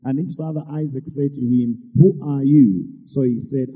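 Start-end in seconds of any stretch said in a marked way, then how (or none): 2.0-2.7 sudden loud startled question. Acted. Blind father startled by his son, loud question. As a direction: blurt it out in alarm. Isaac startled, voiced in reading.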